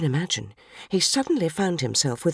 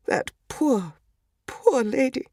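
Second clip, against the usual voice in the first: choked-up pity for the poor lady; tearful pity; 5 semitones higher, slower than usual. Acted. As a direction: voice catching on a sob, rising on the second poor. Get another piece of grit in my eye.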